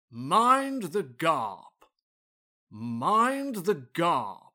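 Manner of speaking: slow, grand "Mind the Garp", with an R; Garp for gap. Acted. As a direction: stretch "Garp" out, rhyming with harp. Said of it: "You did the same thing again".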